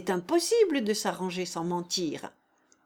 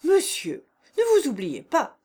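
indignant rebuke; 6 semitones higher, 6 dB louder than usual. A narrator's reading. General rebukes insolent Klinevitch.

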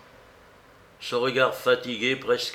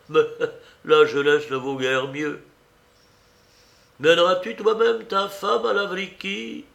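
put-on higher, louder old lady; animated elderly woman, pitched up; fussy old aunt; 6 semitones higher, 5 dB louder than usual. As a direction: lament and prod as a lively aunt.

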